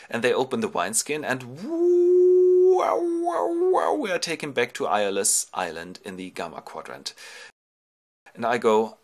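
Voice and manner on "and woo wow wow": high whooshing wind noise; howling wind. Acted. The released winds blow the ships back, high howl. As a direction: whistle like a storm wind.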